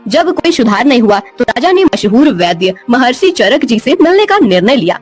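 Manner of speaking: ordinary delivery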